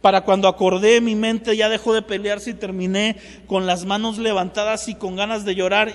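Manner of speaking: ordinary delivery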